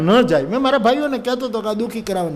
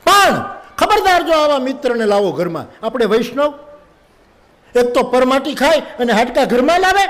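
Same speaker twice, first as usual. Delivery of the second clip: angry wife; 7 semitones higher than usual. Retold or imitated. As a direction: rattle off her duties, pitched up high. Wife scolding husband.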